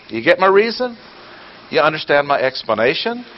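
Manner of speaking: rapid-fire questions, high and pressing; preaching at full force. Acted. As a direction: hammer each question home. Preacher drilling the congregation.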